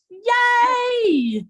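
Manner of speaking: high, loud, long-held yay; big celebratory whoop; excited cheer. Real, not acted. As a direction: cheer it out with delight. Cheer at getting paid.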